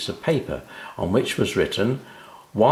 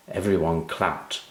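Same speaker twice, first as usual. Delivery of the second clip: ordinary delivery, nothing marked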